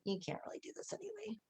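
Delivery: high, hushed, quick taunt; a tiny undermining voice at the back of the head; nagging inner voice. Acted. Coach voices self-doubt to the group, hushed nagging. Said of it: "part of you in the back of your head going".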